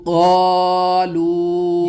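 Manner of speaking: very slow "قالو" with "قا" held long; teacher blends "قالو" slowly; joined word, drawn out. Shown as a demonstration. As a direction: stretch "قا", then "لو". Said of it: "بڑا قاف کھنچے گا".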